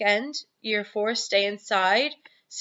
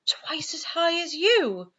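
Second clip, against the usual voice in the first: breathless whispered gasp of alarm; whispered cry; 9 semitones higher, slower than usual. A narrator's reading. Sophie whispering her shock at the giants' size. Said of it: "cried Sophie".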